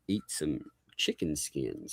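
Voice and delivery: soft, slow deadpan; hushed. Real, not acted. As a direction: say it low and slow like a secret. Deadpan announcing snack.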